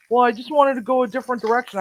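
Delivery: high, fast, defensive mock of the cook; whiny excuse. Chef mocking the new cook's excuse, high and fast. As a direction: mimic his fast, high-pitched excuse. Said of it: "I go".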